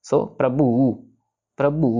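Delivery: "प्रभु" with a heavy, pressed भ; weighted bh. Shown as a demonstration. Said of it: "थोड़ा सा भार दे के". ("प्रभु" में भ पर भार दे के)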